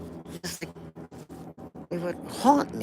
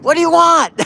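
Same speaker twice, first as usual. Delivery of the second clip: much higher, louder mock shout from the window; neighbor shouting back; 14 semitones higher, 13 dB louder than usual. The shirtless neighbor shouting down at him. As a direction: bark it down from upstairs. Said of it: "he's like".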